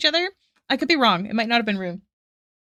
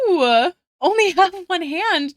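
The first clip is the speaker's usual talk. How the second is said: excited gasp; 7 semitones higher, 7 dB louder than usual. Real, not acted. Gasps at artwork.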